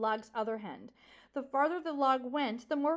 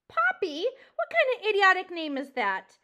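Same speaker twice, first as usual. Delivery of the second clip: high, loud incredulous scoff; mock the name loudly; scornful disbelief; 5 semitones higher, 8 dB louder than usual. Porcupine mocking the mouse's name, scornful.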